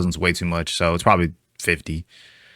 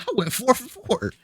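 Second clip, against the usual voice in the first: very high, softer squeal of triumph; elated boast; 6 dB quieter than usual. Real, not acted. Streamer celebrates acing the pop quiz, elated. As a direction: marvel at your own win in a high voice.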